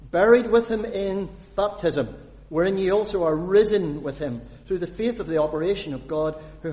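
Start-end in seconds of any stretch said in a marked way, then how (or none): none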